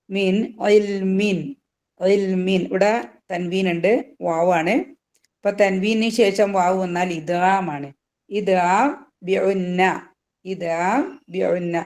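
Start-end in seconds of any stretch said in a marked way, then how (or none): 0.1-1.4 മിൻ ഇൽമിൻ very slow, the ന് sounded clearly; clear nūn. Shown as a demonstration. Teacher demonstrating clear izhar to students. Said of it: "ഐന് വന്നാൽ ഇദ്ഹാർ". 8.3-11.7 ഇദ്ഗാം ബിഉന്ന said slowly and deliberately; slow term recital. Shown as a demonstration. Teacher naming the rule slowly for the class. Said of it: "മണിച്ചുകൊണ്ട് ലയിപ്പിക്കുക".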